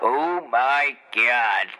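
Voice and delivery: slow drawn-out groan. Real, not acted.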